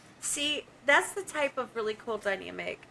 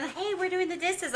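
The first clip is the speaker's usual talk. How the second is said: mocking voice; 8 semitones higher than usual. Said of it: "every time that any of y'all are doing".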